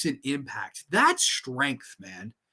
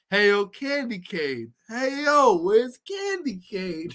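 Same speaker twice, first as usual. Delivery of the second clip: slow drawl climbing higher; Rocky impression; 4 semitones higher, slower than usual. Joking as Rocky looking for candy cane, slow rising impression. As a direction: call out slowly, rising at the end. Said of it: "going on a Rocky Four hiatus".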